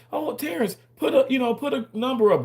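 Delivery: high mock voice; mocking the chat. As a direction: squeak it like a nagging viewer. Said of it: "y'all say".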